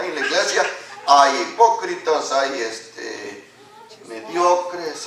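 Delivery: ordinary delivery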